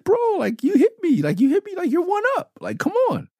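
a giddy, wild-eyed dare; psyched. Retold or imitated. Rapper taunting the kid who hit him, squeaky manic glee. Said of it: "I'm psyched".

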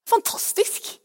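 breathy, hushed wonder; awed whisper. Real, not acted. Preacher marvelling at the Holy Spirit's presence, awed whisper.

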